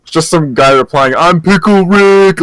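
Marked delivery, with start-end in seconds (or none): 1.1-2.4 high, loud, drawn-out catchphrase; exclamation-mark yell. Retold or imitated. Reading out some guy's tweeted reply, mock yell. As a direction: call it out slowly like all exclamation marks. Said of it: "a bunch of el-, exclamation marks".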